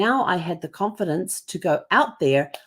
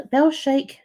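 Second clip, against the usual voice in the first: normal talking voice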